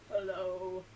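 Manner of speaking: low, stretched-out hello. Shown as a demonstration. Storytime host repeats the lowest hello for children, pitched low. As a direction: sink to the bottom of your voice. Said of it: "Let's say hello as low as we can".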